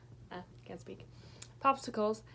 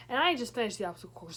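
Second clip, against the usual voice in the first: ordinary delivery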